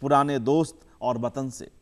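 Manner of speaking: measured recital with long pauses between phrases; poem recitation. Acted. Poet reciting his poem to the audience, measured and unhurried. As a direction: savour each image, let the lines breathe.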